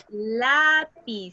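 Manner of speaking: Lápiz drawn out, weight on LÁ; slow demo of Lápiz, LÁ marked, falling after; stress on LÁ. Shown as a demonstration. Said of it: "donde yo marco más la entonación".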